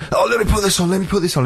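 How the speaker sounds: put-on high, pushy drunk; mock high-pitched badgering; drunk pest. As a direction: imitate a pleading drunk DJ. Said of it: "He was all drunk".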